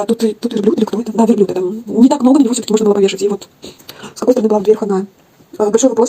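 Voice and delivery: ordinary talk, nothing marked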